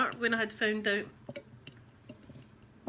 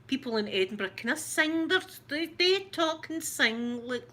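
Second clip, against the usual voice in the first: sing-song Edinburgh accent; 6 semitones higher than usual. Shown as a demonstration. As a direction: sing the words like someone from Edinburgh. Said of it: "like that, and we're just rough".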